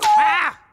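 sudden high, very loud cry of shock; a short startled shriek; shocked scream. Acted. Finds the snail dead.

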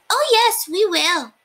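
little mice shouting yes; eager boys. A narrator's reading. Boys promising Papa eagerly, high and excited. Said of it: "said the boys".

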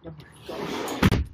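breathless whispered gasp of relief. Real, not acted. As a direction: whisper it in shock. Dog scare aftermath.

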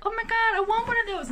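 mock-eager gushing; excited quote. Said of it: "And we were like".

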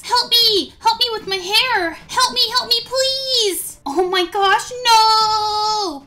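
high frantic pleading screams; screaming for help. Acted. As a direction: beg loudly for help. Begging for help with hair.

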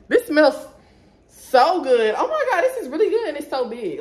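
high, loud burst of real delight; delighted gushing. Real, not acted. Vlogger smells her new candle for viewers, delighted gushing. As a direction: gush over it with a laugh in the voice.

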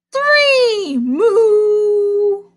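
very high loud strained moo; big heave moo. Acted. Animals pulling cow from fence, loud high moo.

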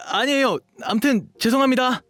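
very high, loud, panicky apology; flustered apology. Acted. Dog boy apologizing to a stranger he bumped into, badly flustered. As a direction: blurt out a flustered sorry.

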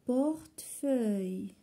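Portefeuille slowly, split before its ending; syllable by syllable. Shown as a demonstration. Teacher showing the last syllable, syllable by syllable. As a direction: pause inside Portefeuille between syllables. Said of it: "هادو كلهم في la dernière syllabe".

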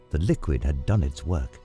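ordinary talk, nothing marked

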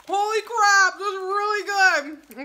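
high, loud, near-tearful amazement; amazed exclamation. Real, not acted. Reviewer floored by the first popcorn flavor, amazed exclamation. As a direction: cry it out high, drawing out the praise.

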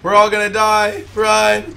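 loud, high mock panic; hammed-up panicked shout. Panic in game.

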